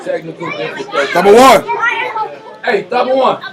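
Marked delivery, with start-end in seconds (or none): 1.1-3.4 loud, high holler, drawn out. Real, not acted. Host calling out during technical difficulties, high holler. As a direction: holler it high to reach someone.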